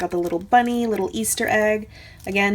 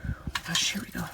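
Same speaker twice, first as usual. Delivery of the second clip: whisper; 3 semitones lower, 10 dB quieter, much faster than usual. Real, not acted. Hushed before embellishment box.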